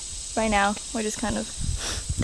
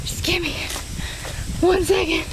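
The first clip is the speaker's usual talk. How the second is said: out of breath; 6 semitones higher than usual. Real, not acted. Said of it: "I'm so out of breath".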